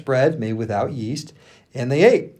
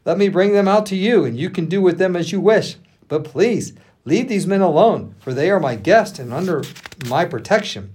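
urgent, higher-pitched plea; louder, impassioned entreaty; begging; 6 semitones higher, 4 dB louder than usual. A narrator's reading. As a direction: plead hard with the crowd, voice raised. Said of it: "he begged".

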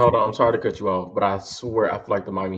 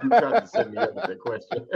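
laughing; 9 semitones higher than usual. Real, not acted. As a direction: laugh while you answer. Laughs at being set up.

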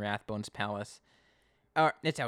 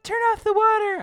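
much higher, loud shrieking command; mock yell; 12 dB louder than usual. Soaked Connie yelling at Simon, high and loud. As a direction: shriek for the water to stop. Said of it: "she's like".

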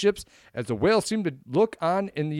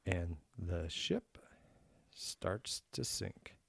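hushed, drawn-out whisper; slow dramatic whisper; 8 semitones lower, 17 dB quieter, much slower than usual. Pretend. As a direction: breathe it out slowly like a spooky tale. Dramatic echo of partner's line.

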